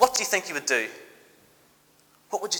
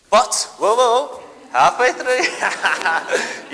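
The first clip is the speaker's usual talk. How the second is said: louder, laughing, drawn-out whoa; 4 semitones higher, 7 dB louder, much slower than usual. Acted. Storyteller teases the children about the coming flood, loud laughing whoa. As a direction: stretch the whoa through a laugh of glee. Water about to close on Egyptians.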